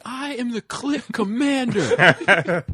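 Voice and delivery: very high, slow, laughing boast; Jay impression. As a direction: crow it like a cocky stoner, cracking up. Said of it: "And Jay was like".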